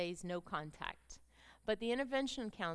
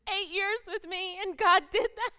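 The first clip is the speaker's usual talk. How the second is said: full-force preaching; 9 semitones higher, 9 dB louder than usual. Acted. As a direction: preach it with everything.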